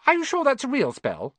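put-on high, sceptical bossy girl. Girl doubting Ron's spell, bossy. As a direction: challenge it like a know-it-all. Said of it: "She had a bossy sort of voice".

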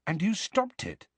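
awed by what he did; mild amazed question. Acted. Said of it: "amazed and impressed".

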